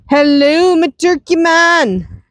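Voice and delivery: high, slow coo to the turkey; pet talk. Real, not acted. Greeting her pet turkey.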